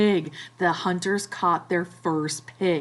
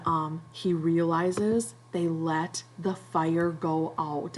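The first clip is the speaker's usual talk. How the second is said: ordinary talk, nothing marked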